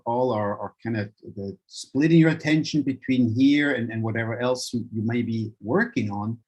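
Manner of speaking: ordinary talk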